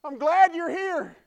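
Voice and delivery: put-on high, loud child's voice calling out; preacher imitating a boy announcing him; loud little boy. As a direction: shout it out like an excited kid. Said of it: "he said quite loudly".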